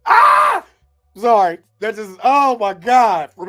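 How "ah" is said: real yell, much higher and louder; excited sports shout. Real, not acted. Watching the game live, shouting in real excitement. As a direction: erupt at the buzzer-beater.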